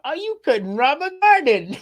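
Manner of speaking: high, jeering put-down; mock taunting woman. Quoting the woman roasting the robber, high scornful jeer. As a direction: roast him in a scornful old-lady voice. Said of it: "she was lighting him up".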